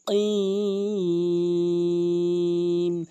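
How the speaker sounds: long chanted কিম from tongue root; correct qaf. Shown as a demonstration. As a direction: punch the q, then hold কিম. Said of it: "ধাক্কা দিয়ে".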